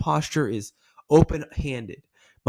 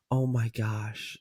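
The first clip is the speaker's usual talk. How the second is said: slow, softer, hushed dismay; demonstrated sympathetic shock; concerned; 2 semitones lower, 5 dB quieter, much slower than usual. Shown as a demonstration. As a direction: react gently to bad news. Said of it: "when it comes to concern".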